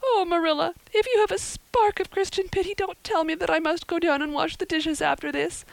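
put-on high, wailing, distraught girl's voice; sobbing girl. A narrator's reading. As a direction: pour out the misery in a high acted wail. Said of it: "sobbed Anne".

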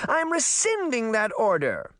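high-pitched spoiled royal; imperious princess. Acted. Princess cancels her own diet order, imperious and high. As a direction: overrule the servant in a high, petulant voice.

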